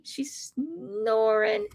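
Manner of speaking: high, slow cooing voice; sweet high voice about a sleeping dog. Real, not acted. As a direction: coo softly over the snoring dog.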